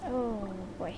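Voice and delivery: usual speaking voice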